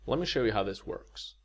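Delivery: normal talking voice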